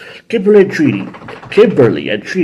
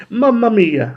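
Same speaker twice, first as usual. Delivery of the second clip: put-on mild Italian accent, raised pitch; 8 semitones higher, slower than usual. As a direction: exclaim like a cartoon Italian chef. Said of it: "why I'm doing Italian".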